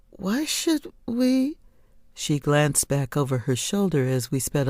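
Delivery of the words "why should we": lightly voiced girl. Girl's question, much higher.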